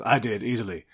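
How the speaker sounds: a hint of Thor's easy boast; casual god bragging, barely put on; smug Thor. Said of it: "And Thor goes".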